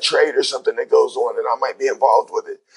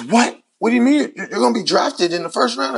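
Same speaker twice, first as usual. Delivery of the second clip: retold disbelief, pitched high; high, mildly astonished retelling; mock astonishment; 9 semitones higher than usual. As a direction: imitate an incredulous agent, voice jumping up. Agent astonished at refusal, retold.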